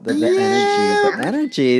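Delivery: high cracking fake-awed voice; sarcastic mock rapture; mock gushing. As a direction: swoon over the energy sarcastically. Mocking New York fans.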